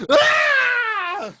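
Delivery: high loud shriek; crazed scream. Acted. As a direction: shriek out like a madman. Crazed man cries out.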